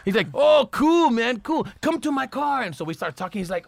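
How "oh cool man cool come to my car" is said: high, excited German tourist voice; lightly accented, high, excited German wannabe gangster; mock German guy. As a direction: gush like an eager wannabe.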